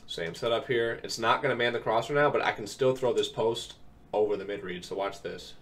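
ordinary delivery

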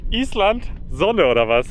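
delighted disbelief at the sun; surprised delight. Real, not acted. Vlogger to viewers, amazed at the blue Iceland sky, surprised.

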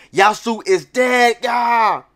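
high, loud cackling cheer; gleeful villain shout. Acted. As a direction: shout it out in triumph. Voicing a gleeful villain crowd.

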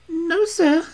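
high, quick, insistent servant voice; a young servant protesting to Holmes, pitched high; anxious young servant. Acted. Servant insisting to the detective that nobody passed, high and urgent.

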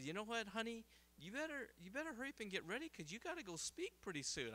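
a hint of a hurrying wife nudging her husband; wife's voice. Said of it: "and my wife said".

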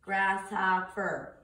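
each syllable drawn out slowly; slow syllable count. Shown as a demonstration. Teacher showing students how to count syllables, slow and even. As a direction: sound out every syllable slowly. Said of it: "You're gonna go".

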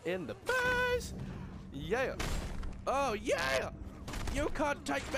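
high, loud fight cheers; gloating excitement. Real, not acted. Player cheering their own punches in a game fight, gloating excitement. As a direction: crow loudly over landing the blows.

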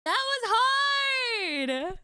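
wailing. Real, not acted. Wailing after failing a song.

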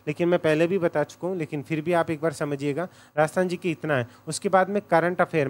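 ordinary talk

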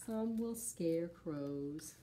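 usual speaking voice

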